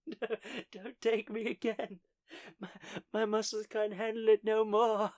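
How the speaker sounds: mock despairing plea, laughing through it; whiny gym dread. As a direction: beg to be spared the gym, half laughing.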